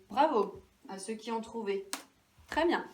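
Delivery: ordinary talk, nothing marked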